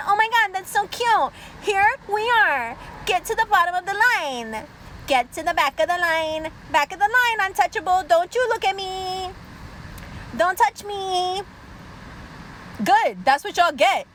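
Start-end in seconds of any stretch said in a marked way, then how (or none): 0.1-11.4 high singsong condescension; mock superiority. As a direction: taunt them like a haughty overlord.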